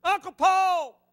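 loud, high calling out; a lost boy shouting from the highest place; crying out. Retold or imitated. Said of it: "I'd cry out again".

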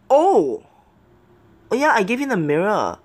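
high, loud "oh" of realisation; real surprise, voice jumping well up; surprised. Real, not acted. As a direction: exclaim as it suddenly dawns. Surprised by the mirror.

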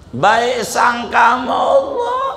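a preacher's rallying cry; emphatic shout. Real, not acted. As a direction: drive the point home with a shout. Preacher calls out the key point.

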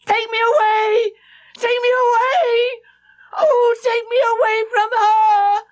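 put-on high, loud, wailing child; furious wail. A narrator's reading. Flora begging Mrs. Grose to take her away, high wailing cry. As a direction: scream it out, clinging and terrified. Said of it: "launched an almost furious wail".

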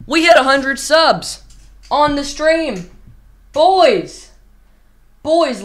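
loud, high hype to the chat; excited celebrating. Real, not acted. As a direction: cheer it to the viewers. Celebrating a hundred subs on stream.

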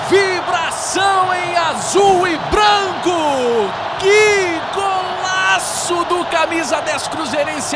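roaring goal call, long stretched GOL; explosive goal commentary. Acted. Goal call, Cruzeiro scores.

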